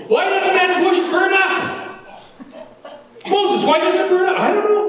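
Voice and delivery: high-pitched, puzzled questioning; baffled onlooker. Acted. Acts out puzzling at burning bush.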